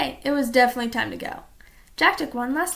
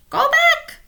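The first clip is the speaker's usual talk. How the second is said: high, loud parrot squawk; 9 dB louder than usual. A narrator's reading. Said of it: "squawked Polly".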